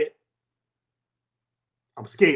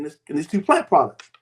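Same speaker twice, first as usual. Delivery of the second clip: ordinary talk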